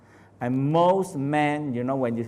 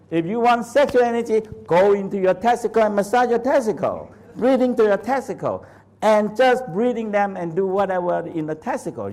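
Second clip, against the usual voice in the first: louder and higher, pressing the point home; firm, raised teacher's command; emphatic instruction; 7 semitones higher, 4 dB louder than usual. Real, not acted. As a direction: drive the instruction hard. Insistent instruction to student.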